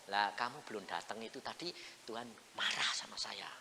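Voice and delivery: whispered confession; father whispering his confession to his son. Retold or imitated. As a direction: breathe it out as a secret.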